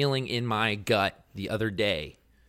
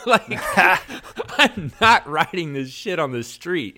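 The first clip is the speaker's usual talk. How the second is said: laughing it off, louder and higher; 8 semitones higher, 9 dB louder, slower than usual. Real, not acted. Telling a friend why he quit the street, laughing. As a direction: laugh through the line.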